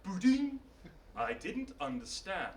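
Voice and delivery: quiet, puzzled robot with a blip first; giant robot. Acted. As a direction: answer softly as an uncomprehending robot. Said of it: "And the giant robot went".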